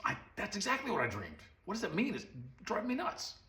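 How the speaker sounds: rising astonishment, almost frantic; amazed excitement. Acted. Pharaoh amazed his dream is told.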